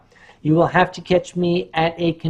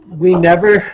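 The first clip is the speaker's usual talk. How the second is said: usual speaking voice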